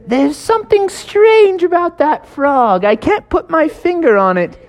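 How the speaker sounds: eye the frog with mild suspicion; suspicious lifeguard. Acted.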